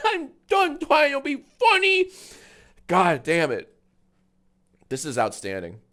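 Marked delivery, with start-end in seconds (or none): 0.1-2.1 high mocking whine through laughter; whiny. Laughing streamer mocks a whiny tweet, high and whiny. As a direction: squeal it like a pathetic poster. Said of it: "so whiny".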